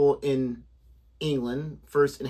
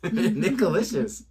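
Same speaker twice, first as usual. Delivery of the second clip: amused laugh; 3 semitones higher, slower than usual. Real, not acted.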